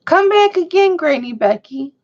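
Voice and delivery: high, loud call to the departed. Real, not acted. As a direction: call her back, longing. Calling out to late grandmother.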